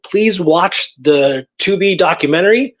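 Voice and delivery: ordinary talk, nothing marked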